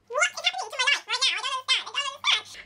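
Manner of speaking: very high, rapid squeaky babble; gobsmacked. Retold or imitated. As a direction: panic happily in a tiny squeal. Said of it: "I was literally gobsmacked".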